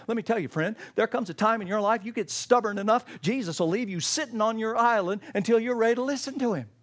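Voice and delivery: ordinary talk, nothing marked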